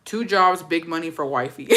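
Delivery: ordinary talk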